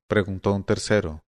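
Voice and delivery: plain reading voice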